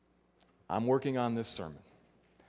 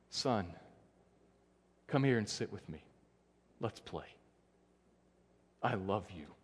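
softer, gentle father inviting his son close; tender fatherly voice; 6 semitones lower, 7 dB quieter than usual. Retold or imitated. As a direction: hush down, like a loving father to a child. God speaking tenderly to his son.

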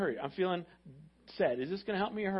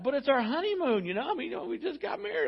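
bewildered husband; 7 semitones higher than usual. Retold or imitated. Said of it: "And he's like".

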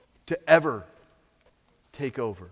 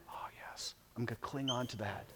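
whispered, much softer reply; 4 semitones lower, 17 dB quieter than usual. Retold or imitated. Discouraged believer answering the preacher, whispered. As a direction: breathe it like a private vow. Voices listener's soft reply.

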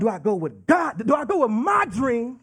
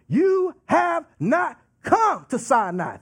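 slow, raised voice spacing out HAVE NOT COME; emphatic; 5 semitones higher, slower than usual. Acted. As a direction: proclaim "have not come" word by word, higher pitched. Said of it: "that is what he's emphasizing here".

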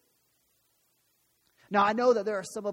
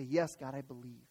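lower, much softer, a surrendered whisper-like prayer; hushed prayer; 6 semitones lower, 5 dB quieter than usual. Pretend. Pastor modelling the congregation's surrender, hushed and low. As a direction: breathe it out to God like a quiet confession. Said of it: "fall before him and say".

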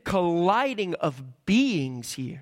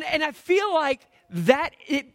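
usual speaking voice

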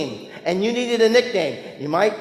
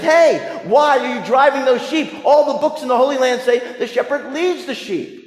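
a raised call across the field; yelling; 5 semitones higher than usual. Retold or imitated. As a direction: shout it out at the far-off man. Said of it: "he yells at them".

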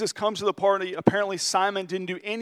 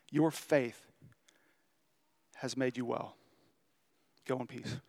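gentle blessing; 5 semitones lower, 9 dB quieter than usual. Acted. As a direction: bless her softly, letting it fade. Jesus blesses the forgiven woman.

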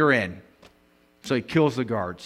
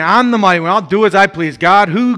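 boastful Herod; 5 semitones higher, 9 dB louder than usual. Retold or imitated. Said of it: "he's thinking".

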